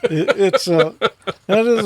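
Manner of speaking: high, loud laughing protest. Real, not acted. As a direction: laugh through the words. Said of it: "it gets me excited".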